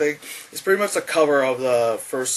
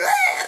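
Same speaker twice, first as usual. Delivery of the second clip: very high imitated shriek; thin acted scream; mock squeal.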